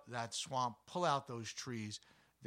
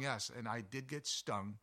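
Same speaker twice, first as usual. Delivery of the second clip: ordinary talk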